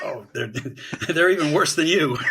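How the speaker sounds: high, loud mock jab, laughing through it; FDR teasing. President teasing Churchill about the French, laughing mock jab. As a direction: tease him like a gleeful president ribbing an ally. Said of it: "F D R would turn to the French and be like".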